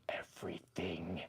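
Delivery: slow, hushed whisper; ominous whisper. Pretend. Answering a chat question about the banana, ominous slow whisper. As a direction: whisper it slowly for dramatic effect. Reading chat punchline for effect.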